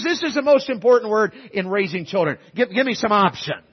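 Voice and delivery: ordinary talk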